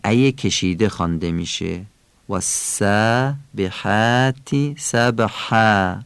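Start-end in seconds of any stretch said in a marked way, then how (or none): 2.3-6.0 والسابحات سبحا slowly, every alef thin; light alefs. Shown as a demonstration. Teacher modelling the verse for students. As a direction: keep all three alefs light.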